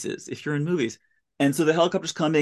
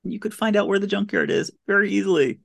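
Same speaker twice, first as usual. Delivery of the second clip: exasperated plea; 6 semitones higher than usual. Retold or imitated. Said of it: "And it's like".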